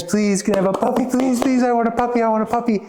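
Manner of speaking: put-on high pleading little-girl voice, tumbling fast; begging little girl. Little girl begging her parents for a puppy, high and pleading. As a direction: beg like a child who wants it badly.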